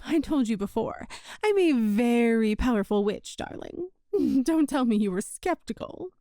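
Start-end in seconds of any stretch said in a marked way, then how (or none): 3.4-3.9 soft whispered darling; whispered endearment. Acted. Witch coos to her childhood friend, barely a whisper. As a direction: breathe the word darling like a tease.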